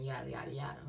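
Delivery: ordinary talk, nothing marked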